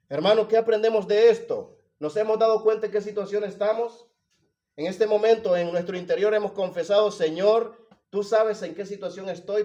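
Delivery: loud, urgent preacher in full flow; impassioned preaching. Real, not acted. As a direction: drive the explanation home to the congregation. Preaches with raised voice.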